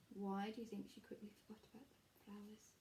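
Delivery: fast whispered aside. Real, not acted. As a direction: lean in and whisper the question. Teacher aside to children.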